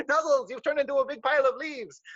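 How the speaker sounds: a hint of a startled squirrel; surprised squirrel exclaims.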